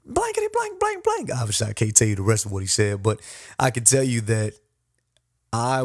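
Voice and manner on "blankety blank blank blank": imitating a drill sergeant's very high, strained yell; mocking, high-pitched drill instructor tirade. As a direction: bark at the recruit like an angry drill instructor. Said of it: "He says".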